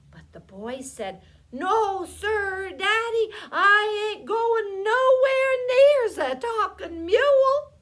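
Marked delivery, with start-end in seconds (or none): 1.5-7.7 high, loud wailing protest; scared refusal. A narrator's reading. Boy refusing his daddy's order to fetch the mule, scared. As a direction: refuse at full cry, rising to the end.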